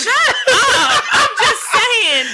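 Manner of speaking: high, loud, laughing shut up; laughing protest. Real, not acted. Wife laughing at husband's teasing, high protest.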